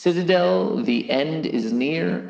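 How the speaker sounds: high, slow spooky voice; eerie high-pitched doom warning; put-on creepy high voice, words stretched out; scary voice. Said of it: "you want a scary voice".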